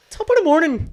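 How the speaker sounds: loud, high-pitched Irish greeting; mock Irish accent. Podcaster joining in the Irish joke, mock Irish accent. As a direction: burst out with a hammed-up Irish hello. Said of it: "Can you do an Irish accent?".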